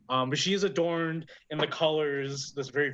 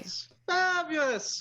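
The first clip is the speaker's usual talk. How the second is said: very high, drawn-out hostess voice; delighted old barkeep; slower than usual. Acted. Dwarf bartender pleased with the patron's order, delighted. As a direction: gush it slowly in a high put-on voice.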